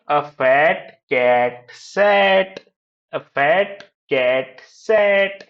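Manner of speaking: very slow, each word set apart; reading out. Shown as a demonstration. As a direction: pause after every word for learners to follow. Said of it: "read out करना है".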